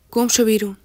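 loud, furious yell; terrifying shout. A narrator's reading. Enraged man yelling at Yalda to get out, loud shout. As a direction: bellow it to scare them off. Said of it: "با صدای فریاد هراسناکش".

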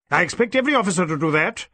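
snapped. Acted. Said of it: "snapped the D S P".

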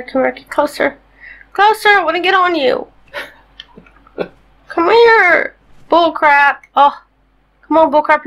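high pleading call to a bird; coaxing a wild bird. Real, not acted. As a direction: sweet-talk the bird over. Coaxing her tamed bird to come.

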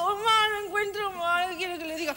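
high, slow moaning reply; hammed-up sick patient; mock feeble patient. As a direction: sound pitiful and weak. Answering nurse feebly.

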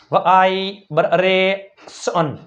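વાઈ, બરેસન very slow, in separate syllables; sounded-out demo. Shown as a demonstration. Teacher spelling from pronunciation, વાઈ બરેસન sounded out slowly. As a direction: draw out વાઈ, then pick apart બરેસન piece by piece. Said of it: "ઉચ્ચાર ઉપરથી spelling".

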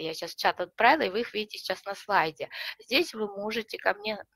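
ordinary delivery

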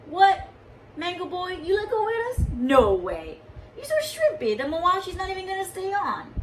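laughing-at-you taunt; mocking brothers. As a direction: jeer at the little brother. Older brothers mocking Mango Boy.

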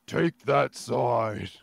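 put-on slow, weak British accent. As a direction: drawl it in a half-hearted British accent. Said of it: "my pathetic attempt at British accent".

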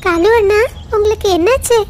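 high little-bird voice; concerned sparrow. Acted. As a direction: ask your crow brother what is wrong, up high. Little bird asks worriedly. (உயர்ந்த சிட்டுக்குருவி குரல்)

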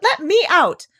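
loud, very high protest; high, desperate little cry. A narrator's reading. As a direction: yell to be let out. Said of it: "cried Piglet".

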